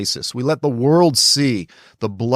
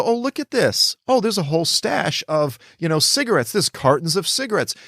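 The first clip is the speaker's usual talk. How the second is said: higher, hammed-up gasp of discovery; mock discovery; 6 semitones higher than usual. Film crew mockingly shows off the hidden cigarette cartons, higher mock surprise. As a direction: play a film crew gloating over a find.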